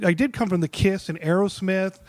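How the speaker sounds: ordinary talk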